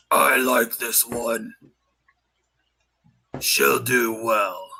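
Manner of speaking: slow, low, deliberate captain; captain's slow approval. Acted. Captain sizing up the new recruit, slow and low. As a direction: weigh each word like a grizzled captain.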